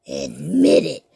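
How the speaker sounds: deep low ghoul demand; very low menacing command; monster demand. Acted. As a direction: growl the order.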